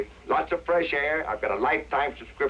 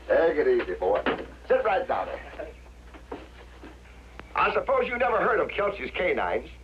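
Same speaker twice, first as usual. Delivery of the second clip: ordinary talk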